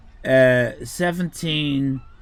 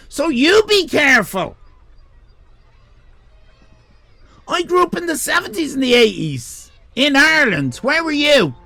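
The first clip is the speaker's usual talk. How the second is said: higher, louder hammed-up Irish threat; mock stern warning; 9 semitones higher, 6 dB louder than usual. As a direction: put the chatter in his place, half joking.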